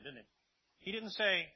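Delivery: usual speaking voice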